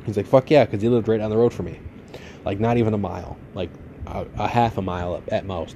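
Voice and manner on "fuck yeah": excited yes. Retold or imitated. Cousin agreeing eagerly to smoke.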